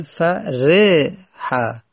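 فرح sounded out as fa-RE-ha, middle syllable loud; فرح syllable by syllable with a clear "e" after r; kasra on the ra. Shown as a demonstration. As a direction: break فرح into slow syllables, leaning on "re".

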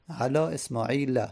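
اسماعیل ending in a short "a", esmaila; fatha ending. Shown as a demonstration. Teacher repeating the example, اسماعیل ending "-la". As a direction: close اسماعیل on an open "-la".

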